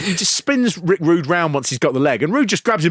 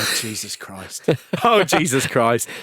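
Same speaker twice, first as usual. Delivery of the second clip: dismayed thought; 3 semitones lower than usual. Retold or imitated. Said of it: "he must just think".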